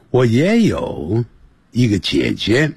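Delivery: normal talking voice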